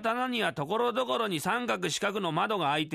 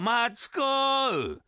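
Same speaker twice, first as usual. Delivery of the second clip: slow mock call, louder and higher; mocking shouted echo; 5 semitones higher, 4 dB louder, much slower than usual. Matsu shouting the reader's slow call back in mockery. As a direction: yell the name back to tease. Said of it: "真似すんな".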